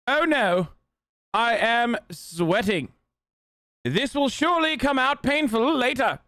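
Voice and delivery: high-pitched mock British voice; high, theatrical British accent; put-on British accent. Streamer puts on British voice.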